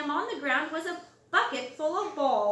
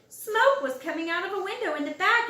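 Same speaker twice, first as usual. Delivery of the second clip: ordinary delivery, nothing marked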